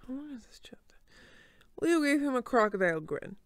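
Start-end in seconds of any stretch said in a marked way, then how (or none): 0.1-0.9 quick whisper under the breath; muttered-off aside, unvoiced. Real, not acted. Reader checking chapter length, whispering.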